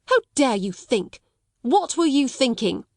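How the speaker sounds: a stern headmistress pressing for an answer; demanding. Acted. Headmistress to pupil, a mock-stern demand. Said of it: "demanded Miss Minchin".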